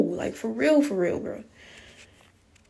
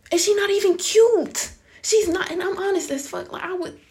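much higher, louder, scandalized; indignant disbelief; 10 semitones higher, 5 dB louder than usual. Real, not acted. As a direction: squeal it in outrage at the insult. Mocking the girlfriend's looks.

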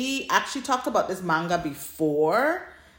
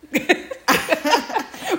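burst of laughter; 11 semitones higher, 5 dB louder than usual. Real, not acted. Joke about Blue Manga.

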